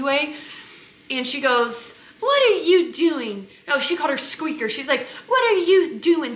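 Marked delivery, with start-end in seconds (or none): 2.2-3.5 higher, drawn-out mock scolding; mimicked accosting. Acting out rude flight attendant. 5.3-6.3 raised, mock-scolding question; mimicked accosting. Acting out rude flight attendant.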